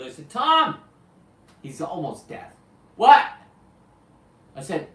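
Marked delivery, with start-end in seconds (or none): 0.3-0.8 shouted name, much higher and louder; calling out. Retold or imitated. Calling to a deaf friend. 3.0-3.3 loud, high shouted what; shouted reply. Retold or imitated. Deaf friend answers shouting.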